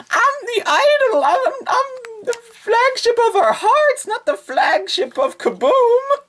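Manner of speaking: very high, slow fake-sobbing voice; Naka crying. Naka whining she's not made for battle, very high sob. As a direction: wail like a pampered idol. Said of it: "And she's like".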